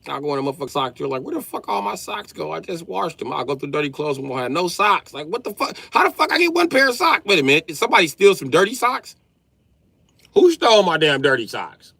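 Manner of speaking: high, loud outraged ranting, building; furious dad searching for his socks; angry rant. Retold or imitated. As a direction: rage about missing socks, voice climbing. Ranting about stolen socks.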